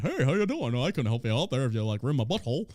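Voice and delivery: smarmy mock politician voice, lightly put on; a hint of a slick congressman brushing her off; Van Taylor impression. Podcaster voicing the congressman paying her off, lightly smarmy. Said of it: "Van Taylor comes like".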